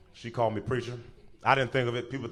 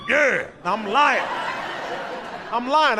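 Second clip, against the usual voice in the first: eager fake yes; 9 semitones higher, slower than usual.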